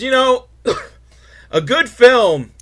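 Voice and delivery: ordinary delivery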